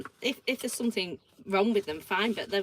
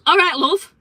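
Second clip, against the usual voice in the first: high, very loud hail; neighbour's greeting; 7 semitones higher, 10 dB louder, slower than usual. Acted. Acting the neighbour at the door, loud greeting. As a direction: hail her abruptly as the neighbour. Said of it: "I'll do it again".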